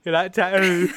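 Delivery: tattoo said tau, loud, laughing; mocking her tattoo. Laughing while teasing a friend's tattoo. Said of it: "take the piss out of the way I say shit".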